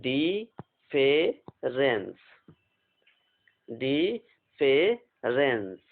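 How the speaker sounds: very slow, drawn-out word for dictation. Shown as a demonstration. Teacher dictating the word to students, very slowly. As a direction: stretch every syllable out. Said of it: "चलिए इसको dictate करते हैं".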